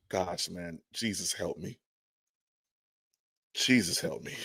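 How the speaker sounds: soft, low pleading to the heavens; despairing mutter. Real, not acted. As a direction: drop the voice as if begging for mercy. Despairing over the trade talk.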